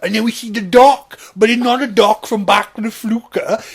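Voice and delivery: very loud, high put-on voice; hammed-up simpleton storyteller; excited film dreamer.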